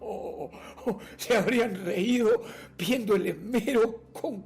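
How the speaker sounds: gleeful deranged confession; amused madman. Acted. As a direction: laugh through the words, relishing your cunning. Madman amused by own cunning.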